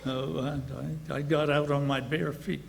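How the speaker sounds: ordinary talk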